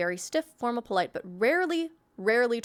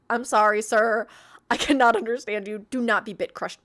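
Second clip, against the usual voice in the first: louder, emphatic mock plea; mock loud apology; 6 dB louder than usual. Player to a garbled voice-changed Bane, mock loud apology. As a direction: project it as to someone you can't understand.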